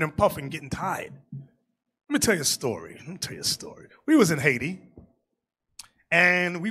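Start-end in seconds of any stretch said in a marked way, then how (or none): 3.0-3.9 hushed whisper, much softer, drawing them in. Shown as a demonstration. Preacher hushing the congregation before a story, whispered. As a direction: lean in and whisper Let me tell you a story.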